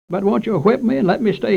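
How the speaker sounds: put-on high, pleading child on the edge of tears; begging, starting to cry. Boy begging his mother to spare him, high and tearful. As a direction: beg her, voice climbing high. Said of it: "I begged as I began to cry".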